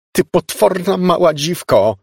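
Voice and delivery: high, loud, drawn-out insult; furious slow yell; angry shout. A narrator's reading. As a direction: hurl it in outrage. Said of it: "krzyknęła Elizabeth".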